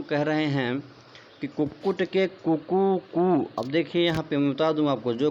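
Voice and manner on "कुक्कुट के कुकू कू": slow, higher-pitched rooster call; rooster crow. Shown as a demonstration. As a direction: crow it like a rooster, drawing out each part. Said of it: "मुर्गा जब बोलता है कुकुड़ू कू".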